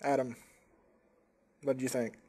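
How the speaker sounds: ordinary talk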